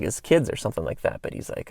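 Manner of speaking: ordinary delivery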